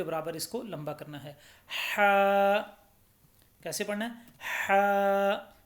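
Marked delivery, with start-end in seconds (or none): 1.7-2.6 हा with a throaty h and held vowel; louder, level, drawn-out हा from the throat. Shown as a demonstration. Teacher shows learners the throat letter, हा held one alif. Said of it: "हलक से इसकी आवाज़ निकलनी चाहिए". 4.4-5.6 हा with a throaty h and held vowel; louder, level, drawn-out हा from the throat. Shown as a demonstration. Teacher shows learners the throat letter, हा held one alif. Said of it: "हलक से इसकी आवाज़ निकलनी चाहिए".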